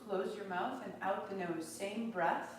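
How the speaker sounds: ordinary talk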